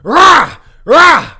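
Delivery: full-throated roar, repeated; roaring shout. Shown as a demonstration. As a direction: bellow it like a lion. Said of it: "I tell him to scream".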